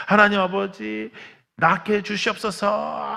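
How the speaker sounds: slow, high, drawn-out prayer voice; mock a fervent group prayer; imitating fervent prayer. Kids praying over the shaking boy, slow and high. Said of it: "기도하고 안수하고".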